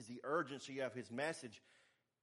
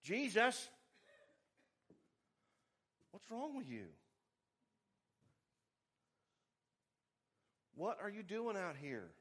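high, loud, agitated disciple calling after Jesus; alarmed Peter hurrying Jesus back; 6 semitones higher, 7 dB louder than usual. Acted. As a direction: scold him like a worried friend. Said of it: "That's what Peter's saying".

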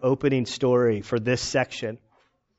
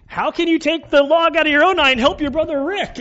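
playful Jesus quote; 11 semitones higher, 4 dB louder than usual. Retold or imitated. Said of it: "and he says".

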